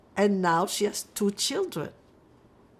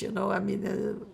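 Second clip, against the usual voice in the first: ordinary talk, nothing marked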